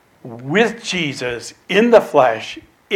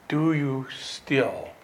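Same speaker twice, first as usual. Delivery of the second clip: hushed reproach; 9 dB quieter than usual. Acted. Jesus asking his forgetful disciples, hushed reproach. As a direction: let the question fade into a whisper.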